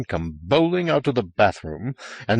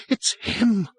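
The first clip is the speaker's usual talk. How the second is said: high, frightened gasp; high-pitched breath of fear; 12 semitones higher than usual. A narrator's reading. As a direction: gasp it out in dread. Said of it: "she gasped".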